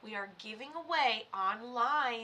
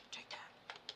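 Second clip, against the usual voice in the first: quick whispered aside; hushed whisper; 14 dB quieter than usual. Acted. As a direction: breathe it out under the breath. Aside during reading.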